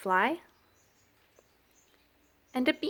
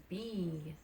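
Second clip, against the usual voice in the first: ordinary delivery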